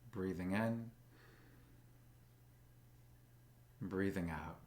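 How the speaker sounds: slow, calm meditation guide with long pauses; soothing, even voice, leaving space for breath; guided meditation. Acted. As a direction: guide them gently into stillness, unhurried.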